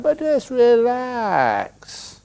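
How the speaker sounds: slow and gentle, easing them down; soothing. Shown as a demonstration. A monk easing a sad listener into calm. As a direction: let the word relax stretch out. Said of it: "slow soothing advice".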